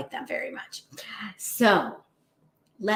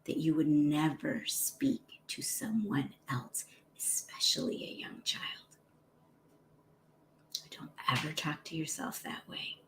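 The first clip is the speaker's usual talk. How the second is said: whisper; 4 semitones lower, 10 dB quieter than usual. Real, not acted. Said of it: "whispered advice to listeners".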